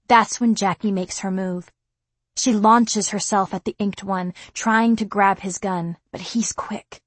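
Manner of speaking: usual speaking voice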